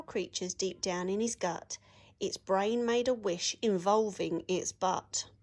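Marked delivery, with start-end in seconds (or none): none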